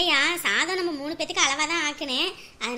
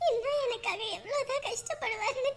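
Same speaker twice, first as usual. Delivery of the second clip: slow, higher, soft weeping voice; tearful; 6 semitones higher, 6 dB quieter than usual. Acted. Daughter pitying her father's struggles for her, tearful. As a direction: murmur it through tears, halting between phrases.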